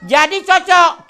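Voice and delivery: high, loud, slow punchline; amused exclaim. Real, not acted. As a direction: exclaim the joke.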